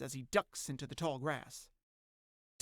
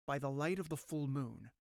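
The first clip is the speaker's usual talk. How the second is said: usual speaking voice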